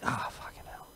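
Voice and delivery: breathy whispered curse, imitating; whispered groan. Host mimicking his friend's tired reaction, in a whisper. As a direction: mutter it under your breath in a whisper. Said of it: "And it's like".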